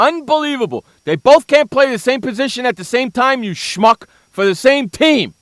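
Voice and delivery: loud, high, indignant outburst; exasperated rant. Acted. Host berating Skip over two quarterbacks, loud exasperated rant. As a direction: rail at him in disbelief. Mock tirade at sportscaster.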